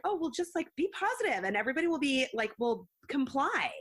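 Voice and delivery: high, sing-song parody of easy optimism; mock naive cheer. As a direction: send up a naive, breezy promise. Mocking what the name implies.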